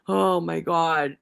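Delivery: slow, drawn-out exasperated groan of words. Real, not acted. Commentator reacting to his story, slow exasperation. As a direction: stretch it out, fed up and amazed.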